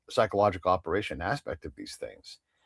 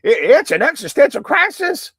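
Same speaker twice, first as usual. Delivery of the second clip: Texas accent; 10 dB louder than usual. Shown as a demonstration. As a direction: put on a thick Texas accent. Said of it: "whenever I slide into my Texas accent".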